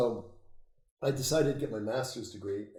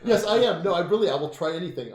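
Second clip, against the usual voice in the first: high, loud, amused confession; gleeful admission; 8 semitones higher, 12 dB louder than usual. Real, not acted. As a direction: own up to it loudly, fresh from laughing. Laughing confession of being game.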